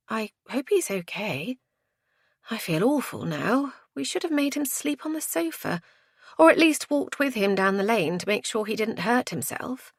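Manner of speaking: high, subdued self-reproach; worried, guilty woman. A narrator's reading. Woman regrets letting him go.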